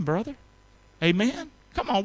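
ordinary talk, nothing marked

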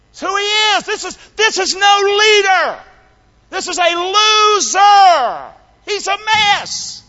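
high, stretched-out denunciation; impassioned shouting. Real, not acted. Preacher denounces Peter as no leader, impassioned shouting. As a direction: holler each verdict, dragging the key word.

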